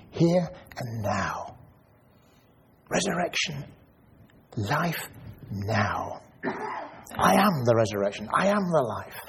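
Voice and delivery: quiet comforting words of Jesus; gentle. Acted. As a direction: hold her gaze and speak tenderly. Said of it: "very gently and says".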